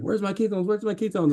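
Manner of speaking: high-pitched mock panic; freaking out. Retelling a cyclist's frantic hunt for his ketones after a stage, high-pitched mock freak-out. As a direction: imitate someone frantic, voice jumping up high. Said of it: "freaking out".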